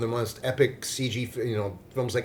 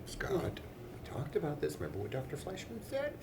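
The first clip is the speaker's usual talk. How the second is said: hushed scolding; 6 semitones higher, 11 dB quieter than usual. Pretend. Mock scolding hushed aside.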